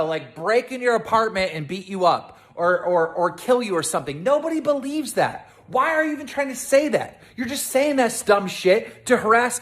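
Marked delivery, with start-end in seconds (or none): 4.2-5.5 exasperated scoff. Real, not acted. [name] repeats rebuttal.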